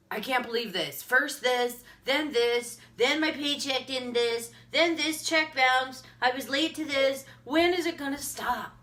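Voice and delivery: mounting list of grievances, pitch climbing; exasperated self-imitation. Reciting her old woes from four years ago, exasperated.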